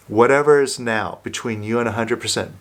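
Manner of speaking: ordinary talk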